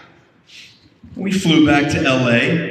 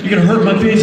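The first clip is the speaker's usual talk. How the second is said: louder mock-wounded whine; mock hurt; 5 semitones higher, 5 dB louder, much faster than usual. Griping to his co-star about leftover donuts, mock hurt. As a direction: play the hurt friend, half joking.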